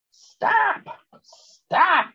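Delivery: very high screamed stop; little sister screaming. A narrator's reading. Little sister screaming at the glunk to hang up, very high. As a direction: shriek it twice to make him quit. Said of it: "my little sister screamed".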